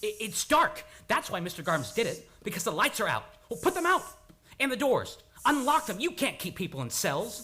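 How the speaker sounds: high, loud, panicked commands; doctor losing control, shouting; frantic shouting. Acted. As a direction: rave in rising panic. Breakdown in the corridor.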